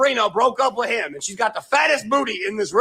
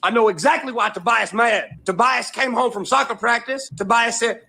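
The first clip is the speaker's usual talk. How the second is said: ordinary talk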